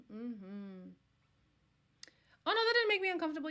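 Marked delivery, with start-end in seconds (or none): 2.5-3.5 fast, high, sarcastic protest; sarcastic denial. Real, not acted. Reacting to a comment, a fast, high sarcastic denial. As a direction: insist too quickly that it was fine.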